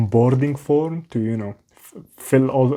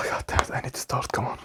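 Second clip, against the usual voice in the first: fast, much softer, whispered self-scolding; frustrated whisper to self; 8 dB quieter, faster than usual. Real, not acted. Vlogger psyching himself up before a cold call, frustrated whisper. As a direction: hiss it at yourself to get going.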